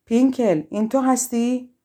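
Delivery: witch's shouted question; shouting out. A narrator's reading. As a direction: call out loudly to someone far off. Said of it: "و اون مثل قبل فریاد زد".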